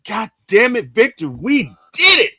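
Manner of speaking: loud, high celebration yell; acting out a jubilant victory call; imagined exultant shout. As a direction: holler it down the phone to your brother. Imagined exultant phone call to brother.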